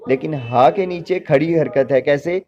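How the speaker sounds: ordinary delivery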